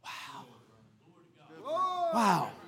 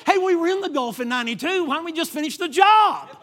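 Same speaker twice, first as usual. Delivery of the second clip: higher, louder appeal building to the last word; emphatic protest; 6 semitones higher, 6 dB louder than usual. Acted. Preacher wishing America fought like David, loud indignant appeal. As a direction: push it up into a rousing demand. Mock protest about fighting wars.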